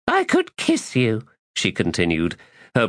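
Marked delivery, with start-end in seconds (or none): 0.1-1.3 high, mocking woman's voice; teasing woman voice. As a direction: tease him in a high voice. Woman teases.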